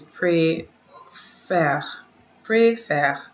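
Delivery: "préfère" with an open "eh" in the last syllable; different E sound. Shown as a demonstration. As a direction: say "préfère" as "pray-FEHR", the second E open. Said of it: "E is pronounced different".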